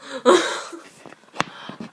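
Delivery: loud burst of laughter; sudden laugh. Real, not acted. Vlogger whose iPad is about to fall, loud laugh.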